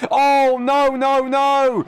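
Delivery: loud high gasp of dismay; shocked outcry. Real, not acted. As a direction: cry out as a car crashes. Crash seen during live call.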